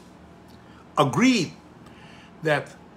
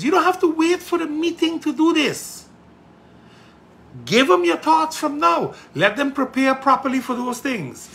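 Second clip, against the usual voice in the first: raised, high-pitched real exhortation; impassioned urging; 12 semitones higher, 5 dB louder than usual. Real, not acted. Politician urging Parliament to act now, impassioned and high. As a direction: urge them loudly, pitched way up.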